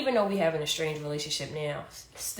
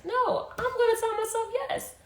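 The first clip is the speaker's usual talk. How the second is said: emphatic high insistence. Real, not acted. Insisting she says yes to herself.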